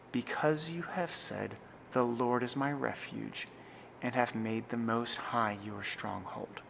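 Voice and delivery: slow, pausing at comma and midpoint; solemn, spacious psalm reading; slow liturgical reading. Shown as a demonstration. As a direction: read deliberately for the congregation to follow. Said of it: "I'll read it through a few times again".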